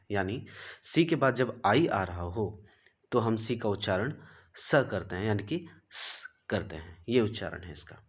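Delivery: usual speaking voice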